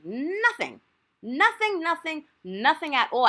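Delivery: high, exasperated repeated denial. Real, not acted. Reacting to being told she's not special, exasperated. Exasperated answer to retold insult.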